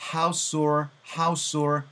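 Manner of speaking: "house or" run together to sound like house sore; linked "house or". Shown as a demonstration. Teacher showing how house or blurs together. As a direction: slide the s of house into or. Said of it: "house sore 다 달려버릴 수도 있어요".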